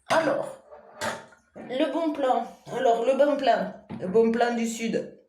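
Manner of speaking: put-on sunny southern French accent, lightly done. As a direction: say it like someone from the south of France. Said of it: "Avec l'accent ou pas".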